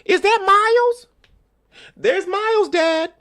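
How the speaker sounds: high, excited put-on voice calling out; coworkers calling out. Coworkers spotting his son on screen, calling out in a high excited voice. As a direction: squeal it like you've just spotted someone famous.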